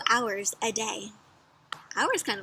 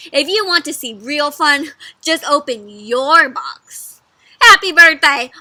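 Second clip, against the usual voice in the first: loud, drawn out, then a burst; proud gift reveal; 3 semitones higher, 13 dB louder, slower than usual. A narrator's reading. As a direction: build up to a loud celebration. Dog proudly presents its gift.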